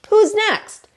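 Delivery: high, bright eager question; eager helper. Acted. Horse to Turkey, high eager question. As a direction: jump in eager to help.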